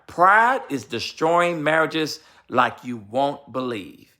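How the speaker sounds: usual speaking voice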